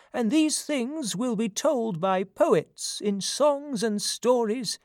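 high-pitched aged prophet voice; old man. A narrator's reading. Blind prophet foretelling a son's glory, in a high old man's voice. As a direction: foretell the future as an old seer. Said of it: "answered the old man".

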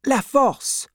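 very high, quick-coming reply; anxious answer. A narrator's reading. Wife names husband's prison to banker, anxiously.

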